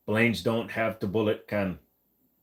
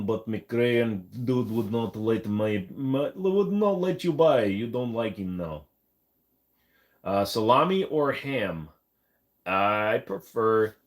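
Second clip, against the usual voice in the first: normal talking voice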